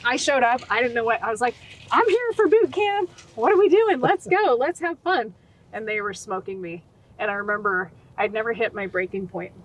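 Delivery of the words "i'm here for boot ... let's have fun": high, loud, bubbly eagerness; chirpy clueless enthusiasm, high and loud; cheerfully clueless new recruit; naive eager recruit. Retold or imitated. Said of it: "a little bit like Private Benjamin".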